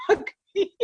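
high, laughing delivery; laughing mock. Recapping the card Sophie got, laughing high. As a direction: say it through a laugh. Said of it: "it's like".

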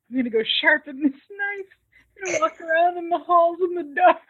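high, increasingly loud mock announcement; retold knife-wielder. Man with a knife telling his companion his plans, hammed-up. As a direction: imitate an odd man announcing ominous plans.

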